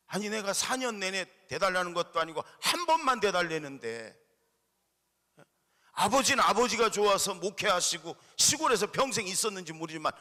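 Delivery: imitating an upset son yelling at his father; angry son. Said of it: "나중에는 막 화가 나가지고".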